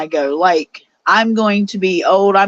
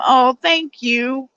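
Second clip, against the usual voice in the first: normal talking voice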